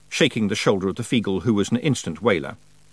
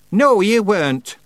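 high, slow, loud contradiction; sharp; 12 semitones higher, 6 dB louder, slower than usual. A narrator's reading. Witch rejects the haggis story, sharp. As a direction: cut him off sharply in witch voice. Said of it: "said Miss Treason sharply".

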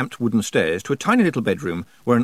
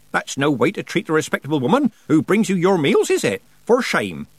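raised, high-pitched telling-off; severe scolding; 8 semitones higher, 5 dB louder than usual. A narrator's reading. Nanny scolds old man about his visitor, severely. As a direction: shame him like a cross old nurse. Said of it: "said Nanny severely".

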